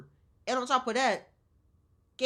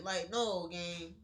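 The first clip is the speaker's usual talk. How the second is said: ordinary delivery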